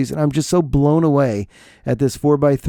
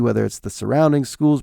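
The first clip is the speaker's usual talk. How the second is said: ordinary talk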